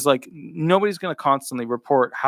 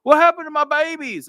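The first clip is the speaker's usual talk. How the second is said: much higher, whiny mock wail; mock Democrat; 13 semitones higher, 4 dB louder than usual. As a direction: parody a fretting Democrat crying over her babies. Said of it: "the Democrats are like".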